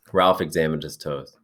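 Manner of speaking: plain reading voice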